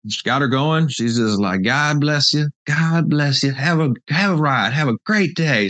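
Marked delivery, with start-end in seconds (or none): 1.6-5.7 higher-pitched Southern lady's send-off; excited Southern-accented blessing. Retold or imitated. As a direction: imitate a grateful lady with a Southern lilt. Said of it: "That sounds Southern to me".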